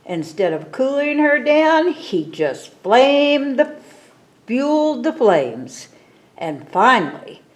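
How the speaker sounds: ordinary delivery, nothing marked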